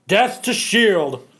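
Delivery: ordinary talk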